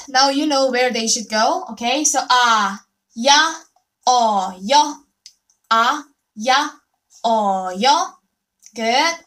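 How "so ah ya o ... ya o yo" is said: ah, ya, o, yo said loud and separate; drilled Korean vowels. Shown as a demonstration. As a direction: call out each vowel clearly for students. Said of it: "let's just make sound".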